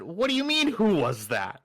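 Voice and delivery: high start dropping on the echoed question; acted-out incredulous reply. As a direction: throw the question back, baffled. Baffled reply to alarmed engineer.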